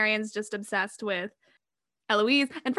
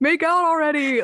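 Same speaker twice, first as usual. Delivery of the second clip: high, loud mock yell at the screen; impatient yell; 6 semitones higher, 8 dB louder than usual. Fan urging film lovers to kiss, impatient yell. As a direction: holler it like a fed-up fan.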